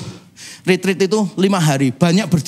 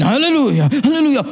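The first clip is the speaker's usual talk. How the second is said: getting louder; 8 semitones higher than usual. Retold or imitated. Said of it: "Terus saya gini".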